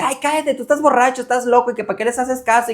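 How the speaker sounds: a grandmother scoffing at her husband; mock dismissive grandma. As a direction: wave him away as drunk and crazy.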